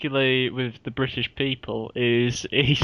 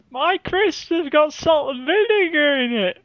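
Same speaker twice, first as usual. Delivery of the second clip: very high mock kid voice; hammed-up childish whine; whining child; 14 semitones higher, 4 dB louder than usual. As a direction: complain like a squeaky little child. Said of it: "the child in the aeroplane".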